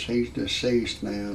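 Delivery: ordinary talk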